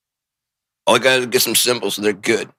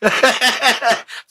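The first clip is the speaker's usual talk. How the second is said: high burst of laughter; helpless high cackling laugh; 13 semitones higher, 3 dB louder than usual. Real, not acted. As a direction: crack up at your own joke. Laughs at own imagined scene.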